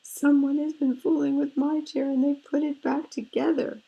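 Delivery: high, loud little cub squeal; squeaky baby bear. A narrator's reading. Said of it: "Baby Bear squeaked".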